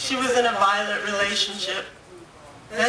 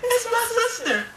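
high, thrilled cry of recognition; overjoyed disbelief; 11 semitones higher than usual. Retold or imitated. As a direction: gasp it out, overwhelmed with joy.

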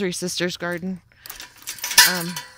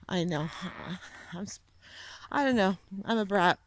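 normal talking voice

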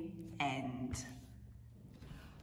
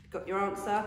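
usual speaking voice

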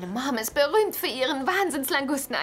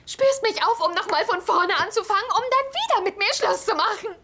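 furious rant; 8 semitones higher than usual. Acted. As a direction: build up the accusations. Furious list of grievances. (wütende Schimpftirade)

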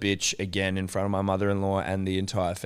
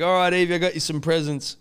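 higher, louder dad announcement; imitated gift-giving dad; 8 semitones higher, 4 dB louder than usual. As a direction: play dad rounding up the birthday child. Imitates dad presenting gifts.

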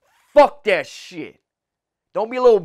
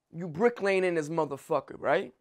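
normal talking voice

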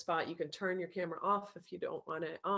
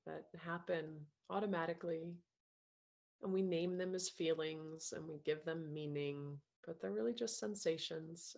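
calm meditation voice, softer than chat, with long pauses; guided meditation; 4 dB quieter than usual. Acted. Coach guiding a group through breaths and self-love, calm meditation voice.

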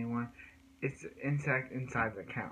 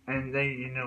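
ordinary talk

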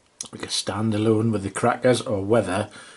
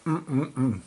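pleased hum; 4 semitones higher than usual. Real, not acted.

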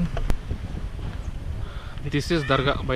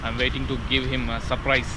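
hushed while hiding; 10 dB quieter than usual. Real, not acted. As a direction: keep it down, you're hiding for a surprise. Hiding to surprise a friend.

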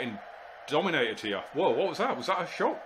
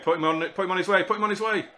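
urging on; 6 semitones higher, 3 dB louder, faster than usual. Real, not acted. Urging a player on a counterattack.

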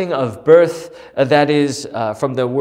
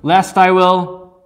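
envious demand; 5 semitones higher, 5 dB louder than usual. Acted. Rachel demanding children from Jacob, envious plea. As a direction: plead it like a jealous wife who wants a child now. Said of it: "she was demanding in her envy".

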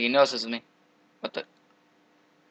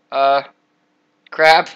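ordinary talk, nothing marked

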